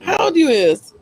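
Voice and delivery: high, loud incredulous questioning; loud disbelief. Real, not acted. Host questions guest about finishing school, loudly incredulous. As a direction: shoot up high in open disbelief.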